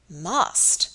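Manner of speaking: "Must?" echoed with an offended rise; indignant echo. A narrator's reading. Surgeon bristling at Robert's demand, indignant echo. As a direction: throw "Must?" back, a touch indignant. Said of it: "echoed Mister Dawson indignantly".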